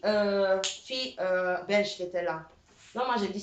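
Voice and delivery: ordinary delivery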